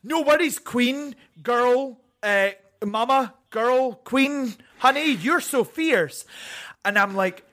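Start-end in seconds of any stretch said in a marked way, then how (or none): 0.0-6.2 higher, sassy drag-speak; drag queen slang. Retold or imitated. Hedging a compliment as drag talk, higher and sassy. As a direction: gush like a drag sister. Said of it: "they'd be like".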